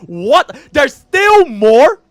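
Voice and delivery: loud, high, slow outraged disbelief; shocked. Real, not acted. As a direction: shriek it in disbelief. Shocked at more waiting.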